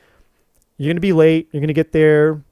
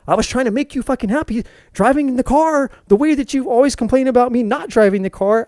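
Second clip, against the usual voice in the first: raised, higher exasperated complaint; frustrated husband rant; 6 semitones higher than usual. Retold or imitated. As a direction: vent at her for making you late.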